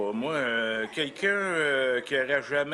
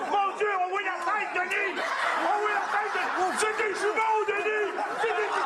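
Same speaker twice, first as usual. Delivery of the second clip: much higher, squealing with glee; excited birth announcement. Acted. Coach announcing twins at the fake birth, high excited squeal. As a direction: shriek the news like a giddy midwife. Mock delivery, seeing the baby.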